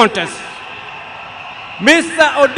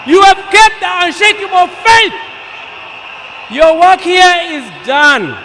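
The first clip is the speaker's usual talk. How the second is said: raised, loud, slow ringing farewell; rousing farewell; 6 semitones higher, 4 dB louder than usual. Real, not acted. Eulogist bidding Baba farewell before the mourners, loud and soaring. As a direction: call out the farewell to the fallen leader with full force.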